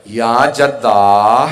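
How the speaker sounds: loud, very drawn-out call of grief; loud lamenting cry. Retold or imitated. Zainab crying out to her grandfather, loud long-drawn lament. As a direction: stretch the call out in imitated weeping. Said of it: "बुलंद आवाज़ से रोने लगी".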